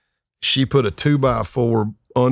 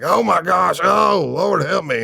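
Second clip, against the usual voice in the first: horrified plea; 10 semitones higher, slower than usual. Retold or imitated. Dudes in truck horrified.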